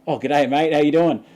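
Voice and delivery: hearty greeting. Retold or imitated. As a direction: greet a stranger with loud cheer. Acted hearty greeting from stranger.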